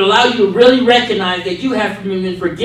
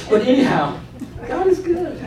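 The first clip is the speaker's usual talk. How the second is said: ordinary talk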